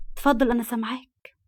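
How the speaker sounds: ordinary delivery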